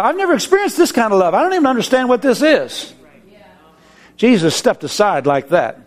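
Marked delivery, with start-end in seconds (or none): 0.0-2.9 high, rushing awe; overwhelmed wonder. Retold or imitated. Man in heaven, overwhelmed by love, rushing and high. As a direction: gush out in amazement.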